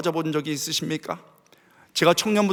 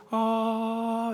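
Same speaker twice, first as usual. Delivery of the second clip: soft, sustained "ah" like harp music; soothing held note; 4 semitones higher, 8 dB quieter than usual. Retold or imitated. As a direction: hum out a gentle, steady tone. Imitates David's soothing harp music. (잔잔하게 길게 끄는 "아" 소리)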